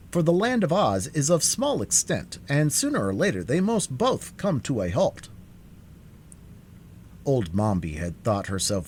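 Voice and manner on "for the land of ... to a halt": lightly voiced, higher-pitched character; reasoning Scarecrow. A narrator's reading. Scarecrow explaining they must halt sometime, reasoning. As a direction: reason it out in a raised voice. Scarecrow figure speaks in narration.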